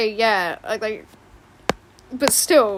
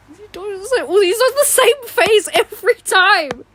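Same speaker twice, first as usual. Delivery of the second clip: squeaky character voice; 9 semitones higher, 9 dB louder than usual. Pretend.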